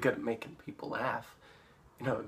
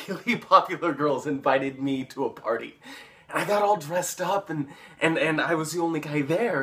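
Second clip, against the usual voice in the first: louder, eager rush of words; excited boasting; 4 semitones higher, 7 dB louder than usual. Acted. Paul boasting about the party invite, excited and insistent. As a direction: insist on it, getting carried away.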